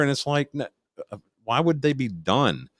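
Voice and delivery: normal talking voice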